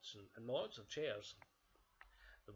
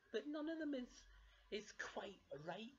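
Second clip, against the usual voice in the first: high-pitched cub voice; Baby Bear objecting; 12 semitones higher than usual. Acted. Baby Bear tells Mother no chair suits, high small voice. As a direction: explain it in a small child's voice.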